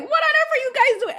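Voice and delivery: high-pitched exclamation in Caribbean dialect; slipping into dialect. Retold or imitated. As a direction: burst out in your West Indian dialect. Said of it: "I slip into my dialect".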